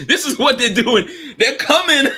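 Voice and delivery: high, laughing delivery. Real, not acted. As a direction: crack up as you say it. Laughing at own story.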